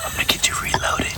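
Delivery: hushed hunting whisper. Real, not acted. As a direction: keep it to a whisper in the blind.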